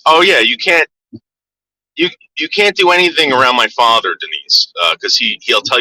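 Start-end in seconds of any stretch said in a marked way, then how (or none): none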